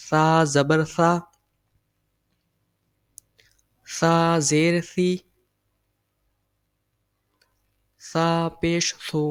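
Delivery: slow, careful drill of the vowels; slow recitation. Shown as a demonstration. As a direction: spell each syllable out slowly for the class. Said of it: "سیڑھی کی آواز بالکل نہیں". (آہستہ آہستہ سبق پڑھانا)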